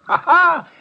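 loud, high whoop of a laugh; triumphant laugh. Acted. Assistant laughs as his boss solves the case, triumphant loud laugh.

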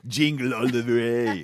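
slow, put-on Austrian action-star voice; drawn-out mock Arnie; Schwarzenegger impression. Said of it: "expect me to go".

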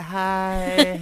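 high, vacant mock hello; a ditzy, empty-headed hi; airhead voice. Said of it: "She's like".

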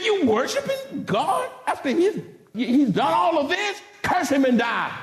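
angry wife. Acted. Grief-stricken wife to Job, angry. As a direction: play the angry wife challenging her husband. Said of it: "she's angry".

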